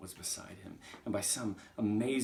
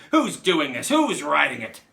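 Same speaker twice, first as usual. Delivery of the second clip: shaken, high, full-volume demand; yelling; 8 semitones higher, 18 dB louder than usual. Acted. Shaken Trunchbull yelling at nobody in particular. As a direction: shout accusingly at the whole room. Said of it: "she yelled at nobody in particular".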